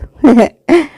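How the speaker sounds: amused short giggle; short laugh. A narrator's reading. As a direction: let out a little laugh. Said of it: "فضحكت وقلت".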